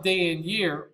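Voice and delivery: ordinary delivery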